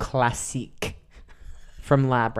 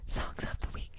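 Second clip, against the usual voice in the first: whispering; 15 dB quieter than usual. Pretend. Repeated title, whispered again.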